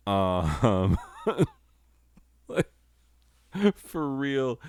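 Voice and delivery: high, breaking into laughter; laughing. Real, not acted. Host cracking up at the absurd slide, laughing. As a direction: talk through a laugh.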